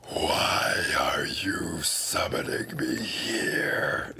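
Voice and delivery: slow, hoarse whispered demon voice; deep rasping demon. Acted. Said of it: "his voice deep and rasping".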